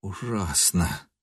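slow, very soft moan of horror; anguished groan. A narrator's reading. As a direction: groan it out under the breath. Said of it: "простонал Нильс и схватился за виски".

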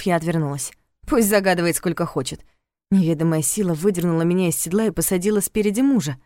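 usual speaking voice

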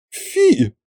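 high squeamish "fi"; disgusted. A narrator's reading. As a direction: wrinkle the nose at it. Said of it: "поморщился Гюнтер".

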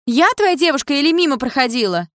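high, loud indignant challenge; outraged girlfriend in a quarrel. Acted.

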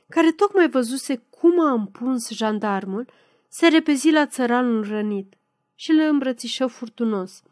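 usual speaking voice

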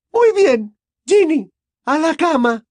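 very high, loud command; shouted order. A narrator's reading. Mother sending her daughter to bed, shouting. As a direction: yell the order at your daughter. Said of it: "gritó la señora Weasley".

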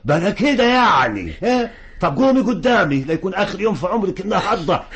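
fast, loud, high shouting at his daughter; angry shout. Acted. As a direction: bark the threat so she gets up now. Father orders daughter up. (صراخ غاضب سريع)

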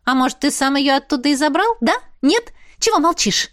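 high, pestering questions; needling interrogation of a brother; insistent prying. A narrator's reading. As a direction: press for an answer, pitch climbing. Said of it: "допытывалась я".

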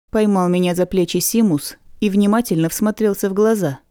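plain reading voice

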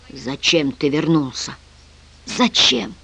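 slow, soft, anguished question; quiet, hurt lament; pained. A narrator's reading. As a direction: ask it with pain, drawn out. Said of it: "с болью воскликнул тот".

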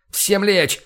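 sharp loud order to take cover; shouted command. A narrator's reading. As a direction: bark it out high and loud. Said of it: "скомандовал я". (громкая команда)

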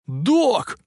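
high, drawn cry of alarm; frantic yell. A narrator's reading. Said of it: "истошно завопил Макфлай". (истошный вопль)

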